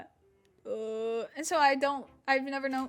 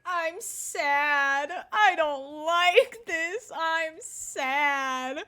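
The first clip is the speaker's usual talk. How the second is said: slow, louder drawn-out wail; loud mock wail; 4 semitones higher, 7 dB louder, much slower than usual. Drugged teen wailing to mom in the car, mock sob. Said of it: "I'm just like".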